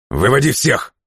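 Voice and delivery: high, forceful shouted order; barked. A narrator's reading. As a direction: bark it as a furious command. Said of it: "рявкнул он". (рявкнуть приказ)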